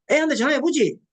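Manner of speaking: lightly voiced husband urging a call; husband's suggestion. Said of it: "이렇게 얘기하는 순간". (아이에게 전화해 보라는 남편, 살짝 크게)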